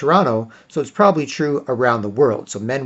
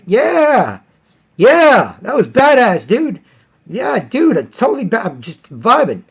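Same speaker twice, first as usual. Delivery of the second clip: put-on high, loud hyped-up boy; be the pumped-up little boy; excited kid voice; 9 semitones higher, 5 dB louder, slower than usual. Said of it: "he's just like".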